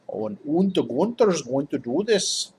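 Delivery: hammed-up German accent, drawn out. Shown as a demonstration. Game coach demonstrates trying accents, mock German accent. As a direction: put on a playful German accent. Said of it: "Do a German".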